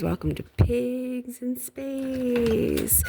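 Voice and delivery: mock TV announcer. Host greeting viewers to Pigs in Space, hammed-up announcer. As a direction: stretch it out like a corny sci-fi show host.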